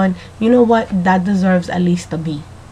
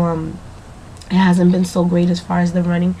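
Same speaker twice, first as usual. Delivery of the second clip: ordinary talk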